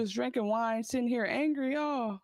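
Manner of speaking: high, playful husband line for an angry wife; the exact line. Shown as a demonstration. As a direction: coach Brandon on a line to say to his wife. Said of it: "I need you to say the exact line".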